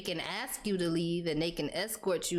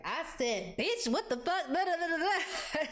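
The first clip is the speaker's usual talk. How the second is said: mock cussing-out, pitched way up; high-energy cussing imitation; 8 semitones higher than usual. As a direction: imitate a worked-up rant tumbling into babble. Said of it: "not giving all that energy".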